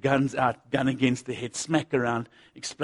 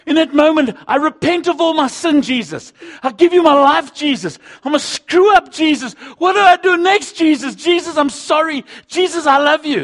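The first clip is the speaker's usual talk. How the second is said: high, loud, impassioned preaching; fervent pulpit voice reliving the night he broke down; preaching at full force; 13 dB louder than usual. Acted. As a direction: cry it out like a desperate prayer from the pulpit. Retells his tearful prayer, preaching.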